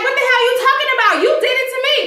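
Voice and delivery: a touch of mock outrage; high, fast indignant retort. Wife firing back at husband, high indignant. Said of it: "And Marcy's like".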